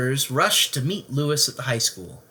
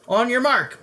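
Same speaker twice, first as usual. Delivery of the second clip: louder, higher coach's bellow; 10 semitones higher, 4 dB louder than usual. Acted. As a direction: call the start across the pool. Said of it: "bellowed the coach".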